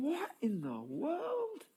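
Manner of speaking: slow, hushed disbelief; shocked muttering. Retold or imitated. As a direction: murmur it as stunned dinner guests. Pharisees shocked by weeping woman.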